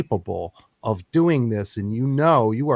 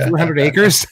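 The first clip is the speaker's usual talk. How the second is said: incredulous repeat; 3 semitones higher, 5 dB louder than usual. Real, not acted. Host repeats in disbelief.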